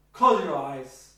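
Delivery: loud, slow "Close your eyes"; firm command. Shown as a demonstration. Therapist contrasting deliveries, loud order. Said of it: "It's different, isn't it".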